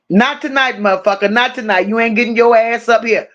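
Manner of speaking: ordinary talk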